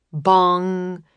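ordinary delivery, nothing marked

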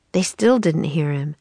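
plain reading voice

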